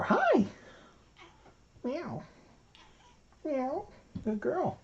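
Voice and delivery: sing-song high voice for a cat; high cooing pet talk. Real, not acted. Owner greets her cat in high cooing baby talk.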